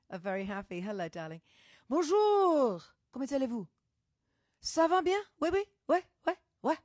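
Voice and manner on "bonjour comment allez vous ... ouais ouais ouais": playful high-pitched French accent. Shown as a demonstration. Host showing off a bit of French, playful French accent. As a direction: show off your French accent. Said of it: "But got the accent".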